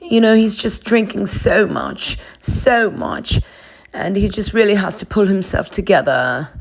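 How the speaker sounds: high, affected voice of mock concern; Lisa. Boss voicing concern about his drinking, high affected voice. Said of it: "Lisa expresses her concerns".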